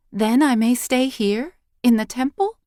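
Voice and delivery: put-on high, hopeful girl's question; hope she can stay, voice lifting; hopeful young girl. A narrator's reading. Girl asking the priestess if she may stay, hopeful. Child Eily's voice in story.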